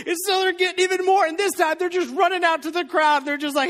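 high, loud, driving sermon voice; fired-up preaching. Acted. Preacher building the miracle story for the church, loud and fired up. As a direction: preach it with rising excitement.

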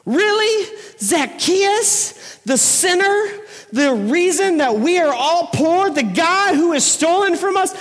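high, indignant mock protest; a hammed-up indignant neighbor; outraged. Indignant churchgoers voiced.

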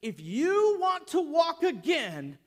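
loud, high, emphatic orders; acted forceful command; commanding instructions. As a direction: lay down the orders forcefully, slowing at the condition. Jesus gives man forceful instructions.

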